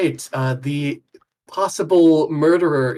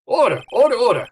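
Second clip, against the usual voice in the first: higher, louder judge's call; calling for order; 9 semitones higher, 5 dB louder, faster than usual. Acted. Judge calling the courtroom to order, loud. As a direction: rap the gavel with your voice.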